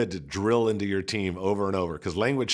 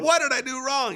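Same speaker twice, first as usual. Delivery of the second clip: alarmed staffer. Retold or imitated. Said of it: "she was like".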